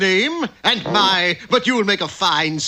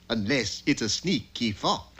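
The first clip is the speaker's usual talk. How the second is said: quiet aside; 10 semitones lower, 8 dB quieter than usual. Acted. Fox mutters aside.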